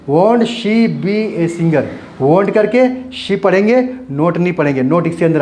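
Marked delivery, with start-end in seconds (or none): 0.1-1.8 won't she be a singer, slow with won't as one word; contracted form. Shown as a demonstration. Teacher modelling the spoken short form, slow. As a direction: read it slowly as won't. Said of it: "तो ऐसे पढ़ेंगे".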